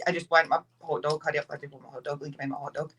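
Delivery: low, fast, fading mutter; retold impatient inner grumble. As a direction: hurry it under your breath, dropping away. Her impatient thoughts while waiting.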